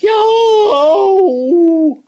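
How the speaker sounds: a very high, loud shriek; screamed at the door in fright; startled yell. A narrator's reading. Lady at the door shrieks at Boris, very high and loud. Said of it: "she yelled".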